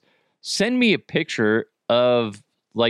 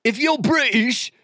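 high-pitched, horrible mock British accent; bad British accent; 10 semitones higher than usual. Host calling on British listeners, badly faked British accent. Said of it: "trying to do their, their accents".

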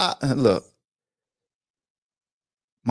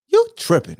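lightly voiced Westbrook, much higher; a hint of Westbrook, pitched up high; imitating Westbrook, high and light; 13 semitones higher than usual. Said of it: "in my Westbrook voice".

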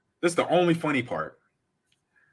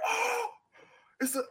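put-on thrilled kid. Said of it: "I'm like".

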